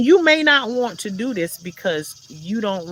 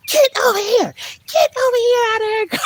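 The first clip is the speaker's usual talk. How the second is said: much higher, louder shout at a dog; neighbor yelling; 6 dB louder than usual. Retold or imitated. Neighbor hollering at her dog in the yard, high loud shout. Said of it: "So she yelling at the dog".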